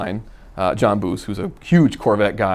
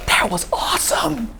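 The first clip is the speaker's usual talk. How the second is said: put-on high, awed kid voice; son's voice; 13 semitones higher than usual. Dad voicing his thrilled son after the stop, high awed kid voice. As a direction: breathe it out like an amazed little boy. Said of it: "He goes".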